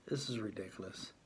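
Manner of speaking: soft unvoiced sigh of disgust; exasperated whisper. Real, not acted. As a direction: whisper it, fed up and worn out. Exasperated end of the rant.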